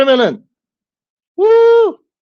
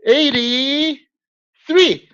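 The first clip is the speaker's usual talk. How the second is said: "Eighty-three" dragged out long and slow as a playful fix; drawn-out correction; 8 semitones higher, much slower than usual. Shown as a demonstration. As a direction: stretch the number slowly, rising then falling. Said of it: "Eighty. Is that right?".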